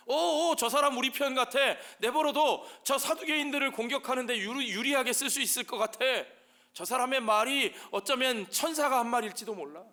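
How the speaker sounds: high, loud, eager mock chatter; clueless excited clamour. Pharisees backing Paul as one of their side, excited clamour. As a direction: jump in noisily as an eager partisan. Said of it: "뭔 소리인지 모르면서 떠드는 거예요".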